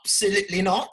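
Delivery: clipped mock-posh absolutely not with a rising laugh; a laughing put-on posh no; mock British refusal. Mocking the UK tour refusal in a posh British voice. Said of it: "they said".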